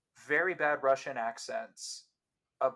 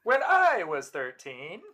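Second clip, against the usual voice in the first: echoing Mulaney; 5 semitones higher, 8 dB louder, slower than usual. Acted. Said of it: "That sounded like John Mulaney big time".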